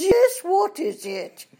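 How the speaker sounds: slow, high, loud haughty dowager; imperious old English duchess. Retold or imitated. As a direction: look down your nose, draw it out. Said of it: "She says".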